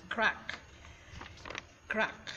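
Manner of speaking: "Crack" said as "krak"; pronunciation demo. Shown as a demonstration. Teacher shows viewers the word of the day, "Crack" as "krak". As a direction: say "Crack" like K-R-A-K, on its own. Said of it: "its pronunciation is like that".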